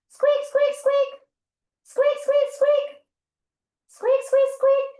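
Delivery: very high, loud squeaking in threes; mouse squeaks. Pretend. Teacher being the small forest mouse, high squeaks.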